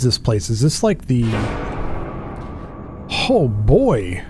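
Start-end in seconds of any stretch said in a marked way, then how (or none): none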